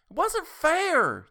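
high, loud, slow wail; desperate cry. A narrator's reading. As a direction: stretch out a loud plea. Tessie protests again.

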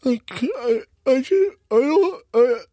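high, garbled mock whimpering; hammed-up, high-pitched pained protest with impeded speech; fork in mouth. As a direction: stammer helplessly round something stuck in your mouth. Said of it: "with the fork in his mouth".

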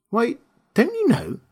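put-on high-pitched girl's surprise; surprised. A narrator's reading. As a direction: ask it high, taken aback. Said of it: "she returned in surprise".

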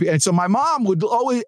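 usual speaking voice

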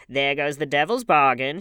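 put-on drunken woman's loud jeer; hoarse jeer. A narrator's reading. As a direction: call it out hoarsely. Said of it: "hiccoughed in a hoarse voice".

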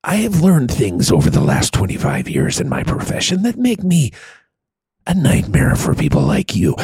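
menacing film-hero monologue, pitch sliding downward; Liam Neeson. Acted. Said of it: "He starts up here and then goes down here".

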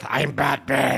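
amateurish put-on superhero voice; a feeble stab at a masked hero; lightly put-on vigilante voice. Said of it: "It's like a five-year-old".

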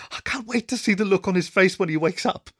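high-pitched gleeful anticipation; giddy conspirator; barely contained glee. A narrator's reading. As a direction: pitch it up, barely holding in the giggles. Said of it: "as they tried to contain themselves".

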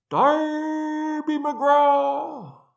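high, long-drawn ghostly hail; distant wail. A narrator's reading. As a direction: wail it from far away, every vowel stretched. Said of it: "it wailed".

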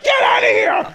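angry shout at the father. Retold or imitated. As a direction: yell him out of the room. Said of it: "she's like".

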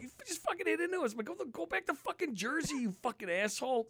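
high-pitched furious retold yelling; telling the guy off at the pond; angry shout. Retold golf course blowup.

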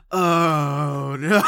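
loud mock crowd dismay; imitating a crowd's oh no; crowd groan. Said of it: "thirty people just went".

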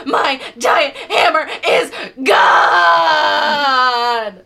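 loud, high, hammed-up bawling; slow, choppy sobbing building to a long wail; through sobs. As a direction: wail it between big sobs. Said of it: "she says, through sobs".

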